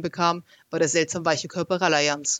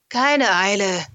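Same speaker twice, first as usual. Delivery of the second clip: pressed, strained and louder; 3 semitones higher, 6 dB louder, much slower than usual. A narrator's reading. Lara hanging somewhere below answers, strained pressed voice. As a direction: squeeze the words out under strain. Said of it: "Sie klang seltsam gepresst".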